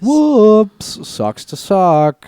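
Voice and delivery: high, loud, gloating singsong; mock sympathy. Real, not acted. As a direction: drag it out loudly with no remorse. Mock sympathy for injured opponent.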